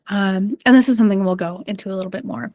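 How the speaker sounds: ordinary delivery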